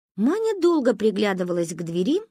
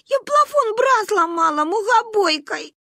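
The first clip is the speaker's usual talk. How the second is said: put-on high, slow child's bawl; wailed confession; 13 semitones higher, 4 dB louder than usual. A narrator's reading. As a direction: wail out your guilty secret. Bawling girl confesses breakage.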